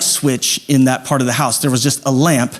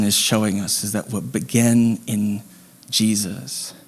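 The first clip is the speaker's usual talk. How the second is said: soft, slow, tender sermon close; hushed heartfelt invitation; quiet reflective preaching; 4 semitones lower, 5 dB quieter than usual. Real, not acted. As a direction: draw them in gently with long pauses.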